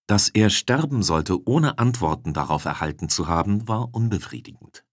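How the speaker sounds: usual speaking voice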